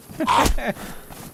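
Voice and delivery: airy laugh trailing off; real breathless chuckle; breathy laugh. Real, not acted. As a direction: let out a helpless breathy laugh. Laughs after quote.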